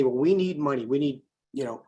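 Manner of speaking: ordinary talk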